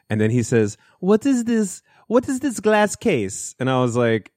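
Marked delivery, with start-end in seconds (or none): 1.0-3.5 high-pitched mock Polish accent; botched Polish accent. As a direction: imitate a Polish man, pitched way up. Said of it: "I was doing a better accent of him earlier".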